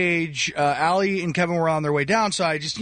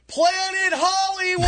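shouting; 13 semitones higher than usual. Retold or imitated. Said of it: "looked at me ... and goes".

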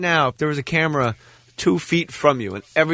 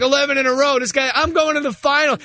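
excited; 8 semitones higher than usual. Retold or imitated. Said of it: "I'm like".

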